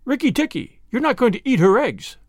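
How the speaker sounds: high, bright-voiced chattering tailorbird; a proud bird praising its hero; excitable little bird. Tailorbird boasting about the mongoose's victory, high bird voice.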